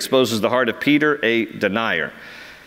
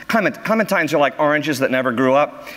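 ordinary delivery

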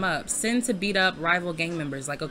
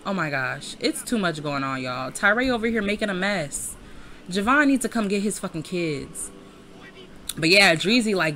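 ordinary talk, nothing marked

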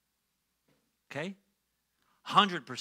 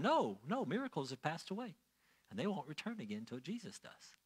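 much softer, falling-away echo of the preacher; child repeating doctrine; 10 dB quieter than usual. Retold or imitated. Child answering whoever asked about miracles, quiet recital. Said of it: "if someone were to ask me as a child".

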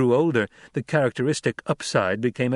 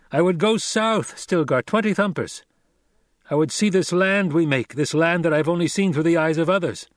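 raised, proud declaration; Paul proclaiming; 6 semitones higher, 3 dB louder than usual. A narrator's reading. Paul declaring himself Fremen, proud. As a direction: declare it to the troop.

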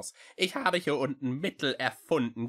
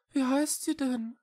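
dejected; 3 dB louder than usual. A narrator's reading. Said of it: "fragte er niedergeschlagen".

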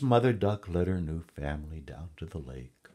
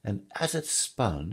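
animated, higher-pitched storytelling king; lively king recounting an adventure; king telling his tale; 6 semitones higher, 4 dB louder than usual. A narrator's reading. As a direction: relish the funny tale, swooping up high. King tells his amusing hunting tale.

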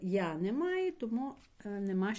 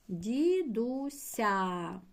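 "Дідуся" drawn out slowly, syllable by syllable; teacher dictating slowly to the class; slow dictation; much slower than usual. Shown as a demonstration. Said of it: "зараз я запишу".

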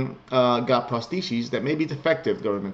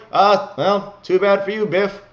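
high, loud, breezy brush-off; gleeful mock farewell, pitched high; mock dismissive send-off; 8 semitones higher, 7 dB louder, slower than usual. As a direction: cheerfully wave off a doomed man, building to a loud bye. Mock dismissal of a condemned man.

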